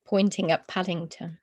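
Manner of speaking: ordinary delivery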